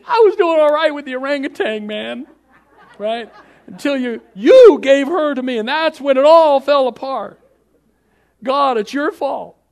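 high, hammed-up indignant outburst; loud blaming protest. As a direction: throw the blame back at full volume. Acts Adam blaming God.

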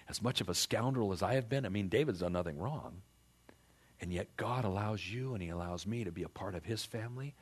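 normal talking voice

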